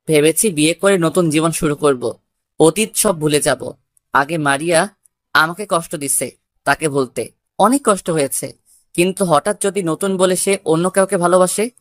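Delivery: ordinary talk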